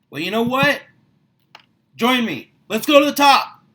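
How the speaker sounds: rally them to the top, full of fire; fired-up rally. Real, not acted. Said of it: "My voice gets louder and it gets higher".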